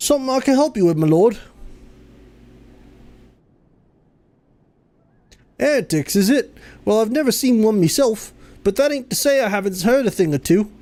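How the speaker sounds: bad Fable-style rustic English accent; awful rustic English accent. Villager answering the lord in a bad rustic English accent. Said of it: "Pardon the awful accent".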